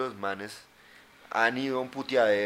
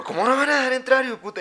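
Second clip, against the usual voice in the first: grito borracho; 13 semitones higher, 9 dB louder, faster than usual. Retold or imitated. Voices the drunk congressman yelling.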